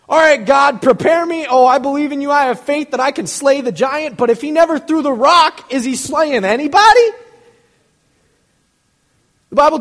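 high, loud, driving preacher voice; full-force preaching. Real, not acted. Pastor preaching to his church at full force. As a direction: hammer the point home to the pews.

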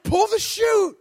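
high, loud scream to pull the chute; panicked yell. Retold or imitated. Skydiver screaming at the instructor, high and loud panic. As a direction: yell it like you're falling with no parachute. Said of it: "I'm like".

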